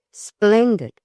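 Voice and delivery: high, loud burst of delight; exclaimed. A narrator's reading. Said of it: "exclaimed Gertrude".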